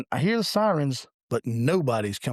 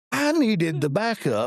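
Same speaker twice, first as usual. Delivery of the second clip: higher, drawn-out retold complaint; mock protest; 6 semitones higher, slower than usual. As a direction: stretch it out as a slow, raised-pitch protest.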